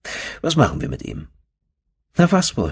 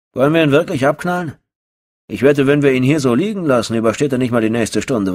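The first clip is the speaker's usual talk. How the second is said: higher, louder, fast and casual about murder; callous thug; 7 semitones higher, 4 dB louder, faster than usual. Acted. Thug asking his buddy whether to shoot the prisoner, brash. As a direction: rattle it off like killing is a chore.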